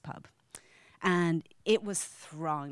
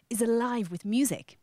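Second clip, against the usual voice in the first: ordinary talk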